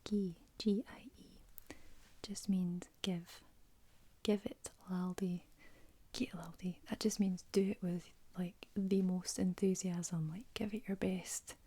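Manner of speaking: Scottish words ASMR whisper. Real, not acted. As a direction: whisper it gently like a bedtime secret.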